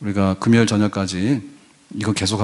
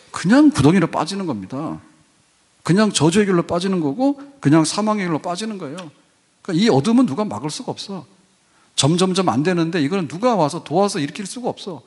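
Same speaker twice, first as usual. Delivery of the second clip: raised, fervent preaching voice; preaching at full force; 7 semitones higher than usual. Acted. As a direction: press the doom home from the pulpit. Preaching climax, raised pitch. (힘주어 외치는 설교)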